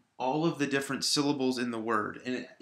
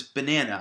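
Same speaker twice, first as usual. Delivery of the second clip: "banana" with the middle syllable stressed; 5 dB louder than usual. Shown as a demonstration. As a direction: say "banana" the standard way, leaning on NAN. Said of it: "we put the stress on the middle syllable".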